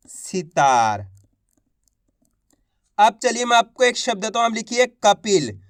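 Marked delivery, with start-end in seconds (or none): 0.1-1.1 सितार said low and deliberate; clear final reading. Shown as a demonstration. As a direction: land सितार low as the finished word. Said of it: "देखो सितार". (सितार को धीमे और गहरे स्वर में पूरा बोलें)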